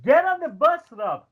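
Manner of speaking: high, loud exasperated shout at the bus door; exasperated yell. Retold or imitated. Pat Patterson shouting at RVD to board, high and loud. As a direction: yell at him to get on the bus. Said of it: "Pat's like".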